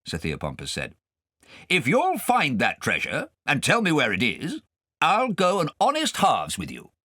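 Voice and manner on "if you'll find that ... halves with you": put-on high, loud blustering old gentleman; pompous guardian. Guardian quizzing Kay at dinner, pompous and loud. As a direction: quiz the boy grandly.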